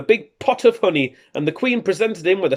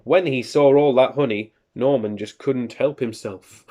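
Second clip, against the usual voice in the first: ordinary delivery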